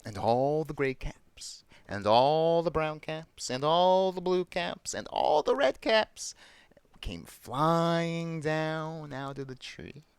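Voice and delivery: slow, building list with stretched words, climbing in pitch; story narration. A narrator's reading. Storyteller as every cap flies down, building wonder. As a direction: draw out "all" and "flying" for suspense.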